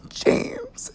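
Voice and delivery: high, soft tearful squeak; imitated crying. Son imitating his tearful mother, high weepy whimper. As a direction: imitate mom choking up on the name. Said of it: "she, like, cries when she says it".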